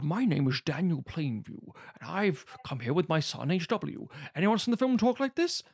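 mock the lead actor's showy period voice; Daniel Day-Lewis as Plainview. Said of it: "Daniel Day-Lewis".

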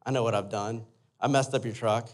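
low, soft sheepish confession; subdued retold admission of guilt; ashamed, head hung low. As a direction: own up quietly to dad, downcast. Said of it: "like, it was low".

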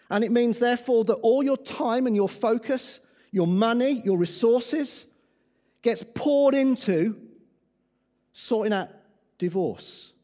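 loud rising preacher build; preaching at full force. Acted. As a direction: drive it home from the pulpit.

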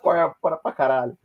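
swearing through laughter; laughing. Real, not acted. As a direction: laugh out the words. Laughing at the tiny audience.

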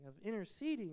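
ordinary delivery

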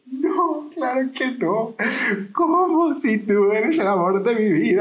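high, slow mock sobbing denial; retold tearful plea, high and slow with sobs; imitating her crying.

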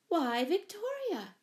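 high, drawn-out surprise; surprised exclamation. A narrator's reading. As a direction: exclaim slowly at an unexpected sight. Mother greets returning lost cat.

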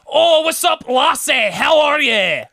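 loud, high shouted Scottish greeting; imitating a goofy Scottish accent at full volume; mock Scottish accent. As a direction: holler it like a hammed-up Scot. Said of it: "saying hello to people and be like".

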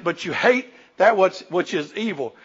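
ordinary talk, nothing marked

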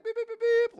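very high beeping noises; imitated car beeps. Car driving past the yelling, high beeps. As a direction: beep like a horn, high and loud.

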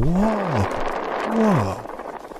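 high, stretched whoa of wonder; amazed whoa. Retold or imitated. Recalling the strange signs, high stretched amazement. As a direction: gape at foreign signs. Seeing signs he can't read.

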